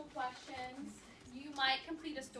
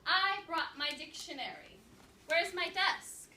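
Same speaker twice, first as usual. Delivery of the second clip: put-on bold new girl; 5 semitones higher, 9 dB louder, slower than usual. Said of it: "said the girl in pajamas".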